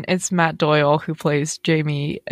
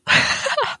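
high giggle. Real, not acted. Reacting to favourite musical solo.

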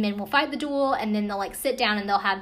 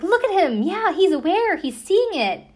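high, loud excited imitation; hammed-up amazement, as if witnessing proof of a ghost; mock excitement; 9 semitones higher, 7 dB louder than usual. Retelling medium freaking out at dog.

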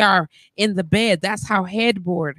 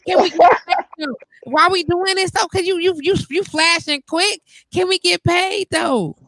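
mock-outraged, keep pressing the question; pushy demand; 8 semitones higher than usual. Real, not acted. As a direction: badger them for the money, pitch up. Demanding money from viewers.

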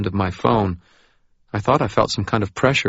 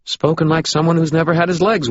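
nervous forced joviality; 9 semitones higher, 4 dB louder than usual. A narrator's reading. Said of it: "in a nervous, forcedly jovial tone".

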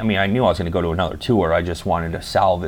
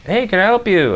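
much higher, bright helpful greeting; high friendly clerk; 11 semitones higher, 3 dB louder than usual. Retold or imitated. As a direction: greet like a cheerful visitor-center lady. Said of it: "this lady's like".

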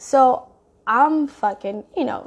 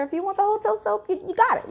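mocking; 11 semitones higher, faster than usual. Mocking his hotel soap choice.

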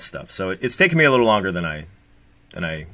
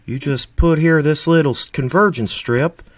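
Steve impression; 6 semitones higher, 5 dB louder than usual. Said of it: "that's my Steve".

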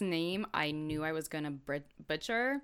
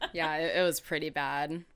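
usual speaking voice

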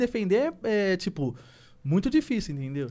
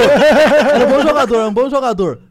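loud insisting; 5 semitones higher, 13 dB louder than usual. Real, not acted. Insisting loudly amid teasing.

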